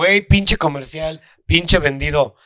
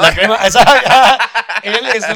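laughing; 5 semitones higher, 4 dB louder than usual. Real, not acted. Teasing his friend while laughing.

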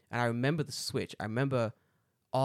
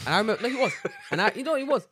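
high, loud, laughing insistence; cracking up while defending the claim; 10 semitones higher, 6 dB louder, faster than usual. Real, not acted. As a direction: insist through real laughter. Laughing while insulting a childhood bully.

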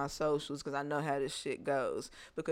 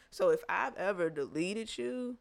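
ordinary delivery

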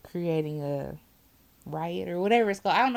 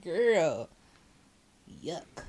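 grossed out. Retold or imitated. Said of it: "I was like".

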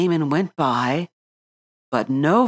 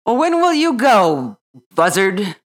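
gruff wildcat; 5 semitones higher, 5 dB louder than usual. A narrator's reading. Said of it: "The wildcat said gruffly".

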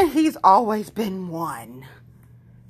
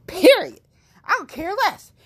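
emphatic shout; 11 semitones higher, 4 dB louder than usual. Real, not acted. Ends vow emphatically.

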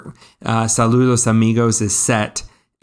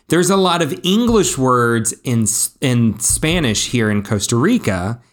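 usual speaking voice

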